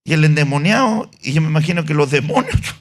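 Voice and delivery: normal talking voice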